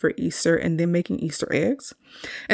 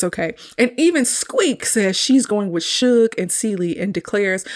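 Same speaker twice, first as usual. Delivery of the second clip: ordinary talk, nothing marked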